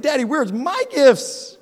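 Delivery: put-on high, slow little-boy plea; child's whine. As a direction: pitch it up like a whining four-year-old. Kid voiced in a retold story.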